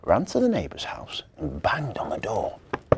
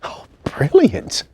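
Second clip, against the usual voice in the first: excited cry; 7 semitones higher, 9 dB louder, slower than usual. Acted. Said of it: "cried William".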